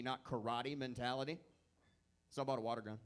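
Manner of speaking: ordinary talk, nothing marked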